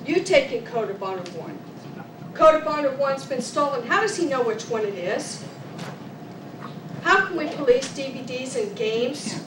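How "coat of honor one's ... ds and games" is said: normal talking voice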